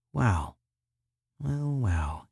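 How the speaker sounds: softly savouring each word; chop-licking tone. A narrator's reading. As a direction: relish it quietly, with pauses. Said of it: "That chop-licking tone was in his voice".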